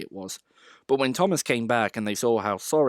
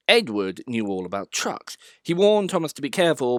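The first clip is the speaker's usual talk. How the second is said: ordinary delivery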